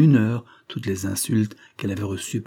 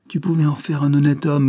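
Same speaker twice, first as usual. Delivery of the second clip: raised, pleading reproach; reproachful; 5 semitones higher, 5 dB louder than usual. Acted. Convict reproaching his mistress, raised voice. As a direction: blame her for what he became.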